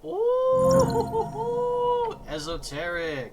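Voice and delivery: very high loud shriek of delight; excited shriek. Acted. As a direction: squeal esoteric with glee.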